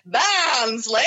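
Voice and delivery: loud mock-aggressive shout; comic shouted town name; shouted Barnsley. Shown as a demonstration. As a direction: shout it right back in their face. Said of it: "shouting right back at them".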